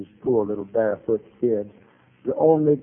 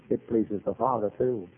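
usual speaking voice

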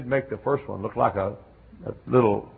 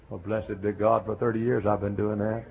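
ordinary delivery

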